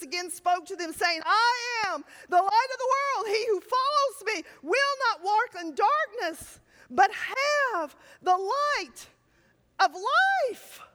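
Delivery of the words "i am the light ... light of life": proclaiming Scripture. Acted. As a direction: declare it like a herald. Preacher declaring Jesus' words.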